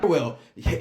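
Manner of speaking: normal talking voice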